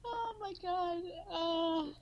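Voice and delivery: high laughing exclamation; laughing hard. Real, not acted. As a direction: crack up while saying it.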